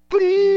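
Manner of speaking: high, loud imitated bleep; mouth-made censor tone over a swear word. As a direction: sing out a piercing bleep. Voices a censor bleep over insult.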